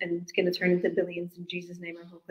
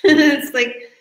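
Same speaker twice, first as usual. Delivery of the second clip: laughing; 7 semitones higher, 10 dB louder than usual. Real, not acted. Laughs at bank's reaction.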